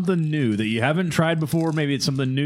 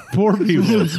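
laughing; 5 semitones higher, 5 dB louder than usual. Real, not acted. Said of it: "Good guess".

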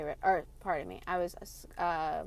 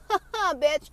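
mocking bird; 8 semitones higher, 8 dB louder than usual. Voicing the robin mocking humans.

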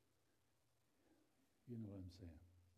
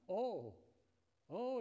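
surprised exclamation; 10 semitones higher, 9 dB louder than usual. Retold or imitated. Elder's delighted reaction on phone.

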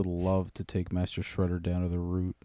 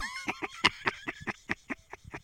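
high, squealing laughing fit; real helpless laugh; laughter; 10 dB quieter than usual. Real, not acted. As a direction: crack up after your own bit. Cracks up at own impression.

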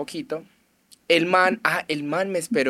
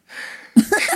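giggle; 4 dB louder than usual. Real, not acted. Laughing at the stranger's offer.

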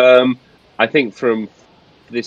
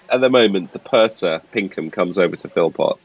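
ordinary delivery

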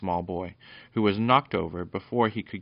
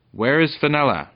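high, loud challenge; brave shout; 7 semitones higher, 12 dB louder than usual. A narrator's reading. Peter challenges the goblin dog over Fenella, brave shout. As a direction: call it out boldly and loudly. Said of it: "shouted Br-, Peter bravely".

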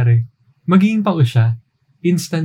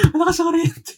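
high mocking echo; 4 dB quieter than usual. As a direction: repeat it much higher, laughing, mocking.

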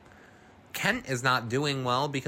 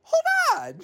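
high squealing hold on; shrieked hold on; 6 dB louder than usual. Real, not acted. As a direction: yelp it out in alarm. Reacting to the scene.

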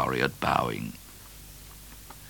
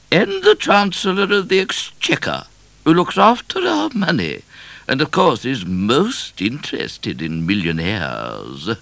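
high, loud, regal introduction; proclaiming queen; 8 semitones higher, 6 dB louder than usual. A narrator's reading. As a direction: present them grandly as a high-voiced queen. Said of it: "proclaimed the Queen".

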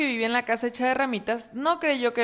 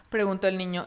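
ordinary delivery

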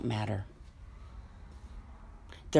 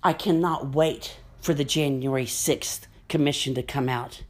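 ordinary talk

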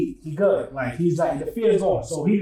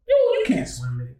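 laughing tease; 5 semitones lower than usual. Real, not acted. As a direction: tease him through laughter. Teasing friend while laughing.

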